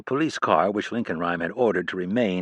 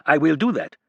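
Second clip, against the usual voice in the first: put-on louder, higher French-accented threat; threatening, French accent; 7 semitones higher, 4 dB louder than usual. A narrator's reading. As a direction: warn her off in a heavy French accent. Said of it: "he threatened in his thick accent".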